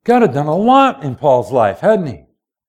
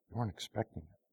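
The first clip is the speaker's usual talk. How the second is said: much softer, almost whispered; hushed; 6 semitones lower, 22 dB quieter, faster than usual. Acted. Preacher to congregation, hushed and intimate. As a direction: drop it to a hush.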